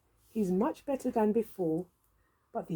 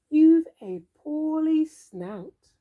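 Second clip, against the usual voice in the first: slow diagnosis; 5 semitones higher, 5 dB louder, slower than usual. Acted. Diagnosis said slowly and loud.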